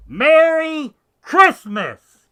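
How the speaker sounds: very loud, high, drawn-out shout; demonstrative holler. Shown as a demonstration. Proving a mask doesn't stop holiday wishes, huge drawn-out holler. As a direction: bellow it, stretching MERRY out long and high. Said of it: "if you couldn't wish a Merry Christmas".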